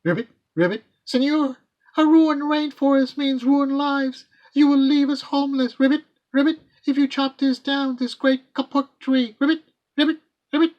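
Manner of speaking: high, loud frog voice with ribbits; piping tree frog. Acted. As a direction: squeak it out like a pleading little frog. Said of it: "a tree frog piped".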